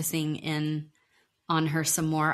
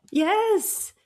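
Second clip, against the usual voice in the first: high excited yes; excited squeal; 14 semitones higher, 4 dB louder than usual. Real, not acted. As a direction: squeal it out, thrilled.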